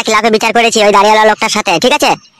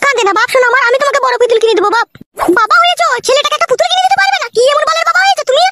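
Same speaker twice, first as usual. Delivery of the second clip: much higher, shrill-pitched wife voice; scolding mother. Acted. Wife scolding husband over crying son, high-pitched.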